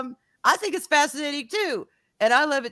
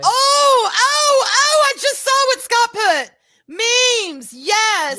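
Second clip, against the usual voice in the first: high, loud, thrilled shouting; excited shout; 12 semitones higher, 10 dB louder, much slower than usual. Real, not acted. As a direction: burst out with delight at a message. Excited at chat message.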